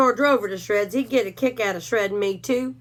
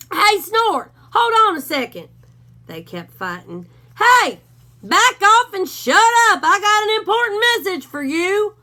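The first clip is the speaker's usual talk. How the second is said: loud shout over a dogfight; calling out; 10 semitones higher, 7 dB louder than usual. Acted. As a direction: yell to break up the fight. Hank yells over the fight.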